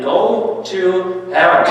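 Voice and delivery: slow, deliberate "Go to hell."; slow rebuke. Acted. Preacher rebukes the enemy, slow deliberate. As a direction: draw out "Go to hell." word by word. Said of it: "really say to the enemy".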